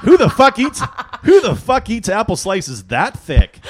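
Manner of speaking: outraged but cracking up; laughing mock outrage. Husband mocking wife's apple slicing, laughing outrage.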